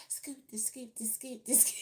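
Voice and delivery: high, quick-stepping chant; high-pitched playful patter; neighbor's teasing imitation. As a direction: mimic a scooting walk in sound. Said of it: "she walk just like".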